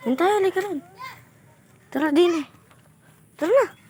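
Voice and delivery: high calling voice. Pretend. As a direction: call them over up high.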